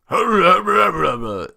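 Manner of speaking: high, loud cheering cry; disguised shout. Acted. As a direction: belt out a fake cheer in disguise. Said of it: "in my disguised voice".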